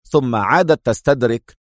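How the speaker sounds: usual speaking voice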